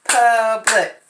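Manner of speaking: Public clapped as pub-lic; syllable clapping. Shown as a demonstration. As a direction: clap out Public. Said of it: "Now let's clap it out".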